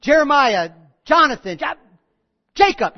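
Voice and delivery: put-on loud, high mix-up of kids' names; exasperated parent shouting the wrong names; parent yelling. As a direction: yell for your kid and fumble the name.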